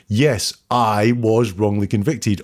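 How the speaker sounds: "Yes, I was wrongly convicted" said slowly and deliberately; slow model answer. Shown as a demonstration. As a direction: spell out the pronoun version slowly. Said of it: "doesn't use any personal pronouns".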